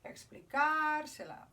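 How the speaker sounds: Explicársela with the stress on CAR, slow and high; Explicársela stressed on CAR, drawn out; stress on the third-last syllable. Shown as a demonstration. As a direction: lean slowly on the CAR of Explicársela. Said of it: "Esdrújula again".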